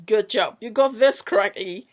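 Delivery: ordinary delivery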